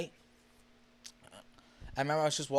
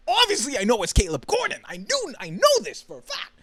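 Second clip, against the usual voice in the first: high, loud burst of conviction; emphatic certainty; 9 semitones higher, 6 dB louder than usual. Real, not acted. Fan insisting he recognised [name], emphatically certain. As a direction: exclaim it as beyond any doubt. Exclaims his certainty about who it was.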